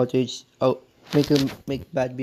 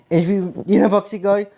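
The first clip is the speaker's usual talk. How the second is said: higher and louder, still raging; real angry shout; 7 semitones higher, 4 dB louder than usual. Real, not acted. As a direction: keep yelling his name in anger. Furious rant at the YouTuber.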